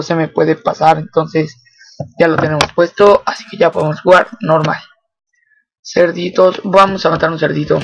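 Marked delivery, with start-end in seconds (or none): none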